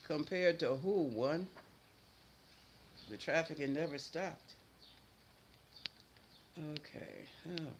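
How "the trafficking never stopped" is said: normal talking voice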